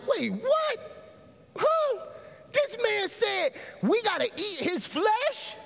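very high-pitched disbelief; comic, high, drawn-out bafflement; incredulous crowd. Acted. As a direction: squawk it as a stunned listener. Voices the shocked crowd.

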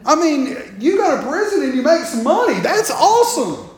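loud, high mock enthusiasm, laughing; sarcastic. Real, not acted. Preacher mocking the idea of a lucrative prison, loud and laughing. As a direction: oversell the joke with a laugh.

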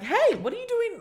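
high startled "Hey, what are you doing". Retold or imitated. To a stranger touching her shoulder, high startled protest. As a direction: jolt up in alarm. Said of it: "I was like".

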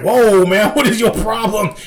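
high, loud imitated disbelief; mock shock. Comedian reacting to old man's rant, mock shocked. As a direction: protest in astonishment, playing it up. Acting out shocked Michael Che.

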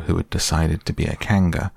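plain reading voice

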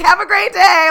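high, loud sing-song farewell; squeaky cheerful. Retold or imitated. The man with the cake saying goodbye: high cheery trill.

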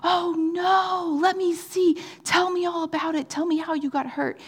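put-on high, childlike voice full of concern; little girl's concern. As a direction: play the sweet little sister comforting someone. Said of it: "And she's like".

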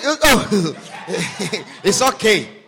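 loud, easy laugh through it; laughing boyfriend. Retold or imitated. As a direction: laugh it off, nothing matters. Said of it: "and it's going".

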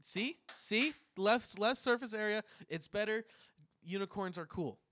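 high, loud triumphant gloating. Real, not acted. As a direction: crow that you were right. Triumphant, vindicated.

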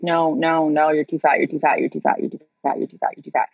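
dismissive rejection. Retold or imitated. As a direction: rattle off the agencies' verdict like a stuck record. Voices agencies' repeated rejections in a run.

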